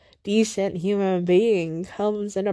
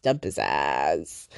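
low, slow, growled "Dump his ass."; deep mock voice; 6 dB quieter, slower than usual. Echoing TikTok advice to herself, deep and slow. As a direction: drop way down and drag it out like a meme voice.

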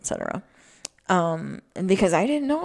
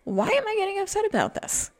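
high, baffled question to herself; baffled self-question; faster than usual. Retold or imitated. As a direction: ask it way up, genuinely puzzled. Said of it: "I had to go".

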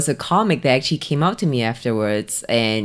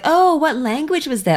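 American roommate; 10 semitones higher than usual. Retold or imitated. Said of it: "my American roommates will be listening".

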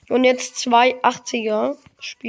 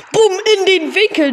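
excited; 7 semitones higher, 6 dB louder than usual. Real, not acted. Celebrating a goal.

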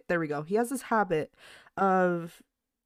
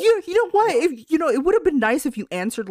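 indignant scolding; 8 semitones higher, 8 dB louder than usual. Retold or imitated. Scolding dad for not answering.

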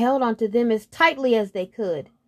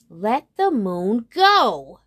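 loud, slow command with weight on each word; demanding; 2 semitones higher, 6 dB louder, much slower than usual. Acted. Unicorn to the hills holding the moon, demanding. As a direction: order it firmly, drawing the words out.

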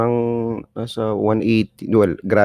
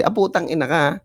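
put-on scolding a kid caught smoking, higher pitched; mock scolding parent, pitched up; mock angry parent; 7 semitones higher than usual. Parent scolding smoking child, mock angry.

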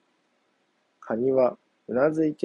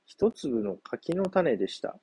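ordinary delivery, nothing marked

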